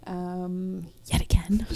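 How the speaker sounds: whispered gloat; smug whisper. Real, not acted. Host gloats in a whisper after being proved right. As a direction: breathe it out smugly.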